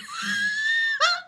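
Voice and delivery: a sudden high squeal of laughter; real helpless high-pitched laugh. Real, not acted. Cracks up at the prose.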